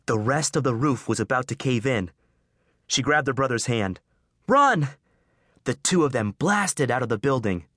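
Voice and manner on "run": much higher, louder yell; shout. Acted. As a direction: scream it as the roof goes. Roof about to cave in.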